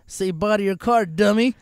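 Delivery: retold gloating taunt; mocking taunt. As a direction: taunt the car owner. Repo man taunting owner.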